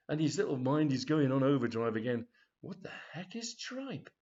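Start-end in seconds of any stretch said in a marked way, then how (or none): 2.6-4.1 higher, softer, slow; puzzled inner voice. Retold or imitated. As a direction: wonder it aloud under your breath. Freddy's racing inner question.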